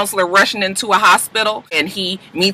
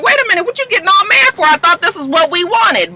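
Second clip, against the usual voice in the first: much higher and fast, mock bewildered; startled protest; 12 semitones higher than usual. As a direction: imitate a friend caught off guard. Connor confused at Michaela.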